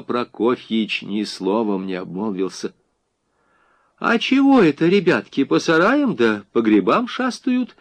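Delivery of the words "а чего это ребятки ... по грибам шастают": high-pitched, emboldened querying. A narrator's reading. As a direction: challenge them like a housewife who spots disorder. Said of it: "перешла в наступление хозяйка". (осмелев, с наступлением)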